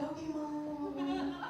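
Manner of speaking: small, soft, slow reply; sheepish son. Acted. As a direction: answer like a scolded kid, dragging it out. Scolded son answers meekly.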